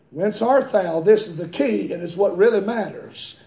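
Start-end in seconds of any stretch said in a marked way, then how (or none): none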